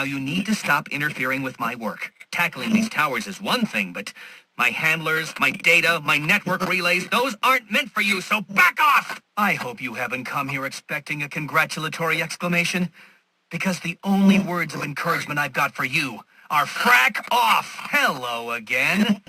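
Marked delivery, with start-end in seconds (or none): 4.6-9.2 building from firm to high, loud shout; mounting angry warning. Acted. Villain warning Batman off his network, building anger. 16.7-17.8 high, loud, punched-out curse; emphatic dismissal. Acted. Villain's only words of encouragement for Batman, angry dismissal.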